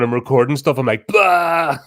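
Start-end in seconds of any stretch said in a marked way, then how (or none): 1.1-1.8 loud, high burst; mock shouting. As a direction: blast it out as if shouting into the mic. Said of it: "I have to stop shouting".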